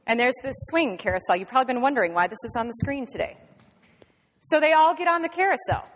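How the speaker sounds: ordinary talk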